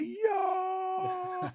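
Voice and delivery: put-on high whooping "Yo" from the boys; a sudden squealing razz, mimicking the crew; crowd whoop. His boys react, ribbing.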